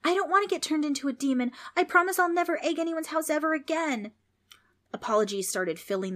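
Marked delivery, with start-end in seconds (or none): 0.0-4.1 put-on high, rushed boyish plea; panicked shouting. A narrator's reading. Preston bargains not to become a demon, crying out. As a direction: beg at a high pitch, tumbling out. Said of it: "Preston shouted into the darkness".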